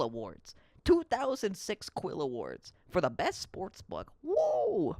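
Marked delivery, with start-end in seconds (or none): 4.2-5.0 surprised whoa. Real, not acted. Reacting to award news.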